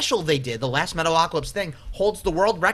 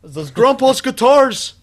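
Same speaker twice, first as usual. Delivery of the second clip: high, loud, slow mock-Swedish voice; cartoon Swedish guitarist impression; 8 semitones higher, 8 dB louder, slower than usual. Friends trading Metalocalypse voices, loud high Swedish impression. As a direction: put on a drawn-out Scandinavian cartoon voice.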